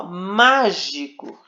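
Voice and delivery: "mágico" com MÁ forte e alongado; ênfase na sílaba tônica. Shown as a demonstration. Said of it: "é a sílaba que leva o acento". ("mágico" com a primeira sílaba destacada)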